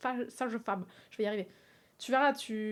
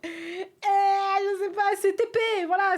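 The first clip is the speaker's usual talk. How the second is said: louder and higher, fed up; annoyed outburst; 7 semitones higher, 6 dB louder, slower than usual. Real, not acted. Streamer fed up with the witch riddle: loud annoyed outburst. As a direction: vent at the puzzle. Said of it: "je suis en train de m'énerver".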